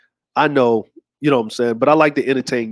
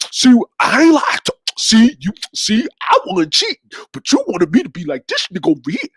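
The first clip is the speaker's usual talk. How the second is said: whining cheater; 6 semitones higher than usual. Retold or imitated. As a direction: whine it up high like a caught cheater.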